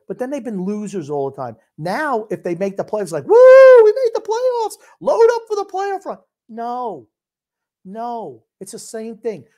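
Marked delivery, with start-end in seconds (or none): 3.3-3.8 huge high laughing whoop; hammed-up fan cheer; mock cheering woo. Mocking hyped fan. 3.8-6.2 much higher, louder fan cheer; hammed-up giddy fan; mock fan excitement. Mocking hyped fan.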